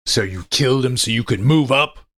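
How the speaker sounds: barked. Acted. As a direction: bark the accusation. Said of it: "I barked".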